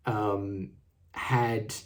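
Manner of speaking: ordinary talk, nothing marked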